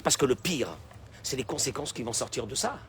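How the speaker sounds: ordinary talk, nothing marked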